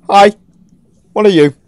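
normal talking voice

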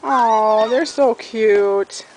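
normal talking voice

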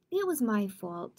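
ordinary delivery, nothing marked

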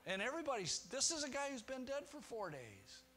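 ordinary delivery

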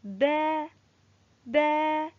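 "با" sounded out as an isolated syllable with an open a; ba with fatha. Shown as a demonstration. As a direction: model "با" evenly for pupils to copy. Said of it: "فنقول". (نطق "با" بالفتحة بوضوح)

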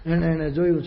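normal talking voice

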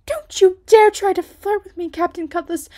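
very high, very loud shriek; outraged yell after the slap; furious shout. A narrator's reading. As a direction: scream at him to back off. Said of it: "Frightened, Ruby slapped him".

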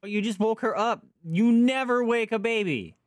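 indignant scolding. Real, not acted. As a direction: build to a loud, drawn-out telling-off. Scolds character for waking baby.

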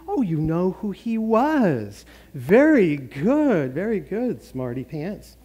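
drawn-out, mock-impressed pastor praise; complimenting pastor. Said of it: "get complimented if there's a pastor there".

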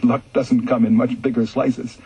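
usual speaking voice

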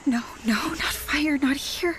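urgent pleading whisper; frantic whisper. Acted. Cassie pleads as the ghost reaches for fire, frantic whisper. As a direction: beg it to stop under your breath.

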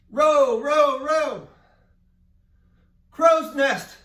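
very loud high calls; shouted commands. Shown as a demonstration. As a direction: bellow each command. Teacher calls game commands loudly.

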